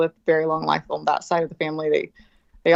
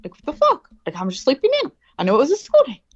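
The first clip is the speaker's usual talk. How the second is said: put-on higher, louder confused complaint of a just-woken kid; groggy annoyed kid; 7 semitones higher, 3 dB louder than usual. Kid to herself after oversleeping, higher confused complaint. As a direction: whine like a kid who overslept and is baffled. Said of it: "I'm like".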